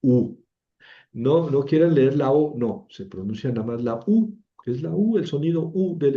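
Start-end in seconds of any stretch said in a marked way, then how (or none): 0.0-0.4 U as a plain Spanish oo; one firm oo for U; Spanish U sound. Shown as a demonstration. Teacher reading the Greek diphthong, U as pure oo. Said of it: "U del griego se pronuncia U".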